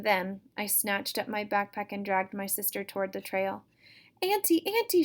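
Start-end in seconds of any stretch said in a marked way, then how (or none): 4.2-5.0 high, loud shriek. Acted. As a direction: shriek for Auntie in a panic. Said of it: "she shrieked".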